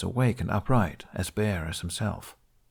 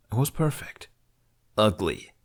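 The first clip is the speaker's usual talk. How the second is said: usual speaking voice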